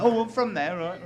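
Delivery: put-on British speech; mock London accent. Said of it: "you said that like you're from".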